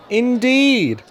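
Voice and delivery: high, drawn-out important man; a lightly voiced pompous boss; Fat Controller voice. Stationmaster reports, Fat Controller answers, pompous. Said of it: "said the Fat Controller".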